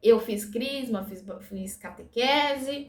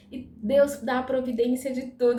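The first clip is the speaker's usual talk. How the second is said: ordinary delivery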